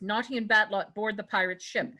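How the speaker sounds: normal talking voice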